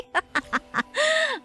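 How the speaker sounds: short, high burst of laughter; laughing. Real, not acted. Streamer amused at herself in game, high laughter. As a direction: let out a high giggle.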